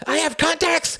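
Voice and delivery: very high, loud panicked scream; desperate scream. A narrator's reading. The Count is hauled from the armchair, desperate scream. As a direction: scream it as a last plea. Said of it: "he screamed".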